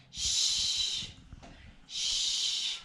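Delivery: long hissed shh, air pushed through rounded lips; shh sound. Shown as a demonstration. Teacher demonstrating a hissed "shh". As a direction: push out a soft sh: "shh". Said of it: "the S H sound says".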